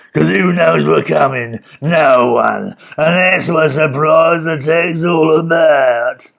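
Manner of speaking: gruff scheming villain; loud, slow villain boast. A narrator's reading. Mister Gum explains surprise attack.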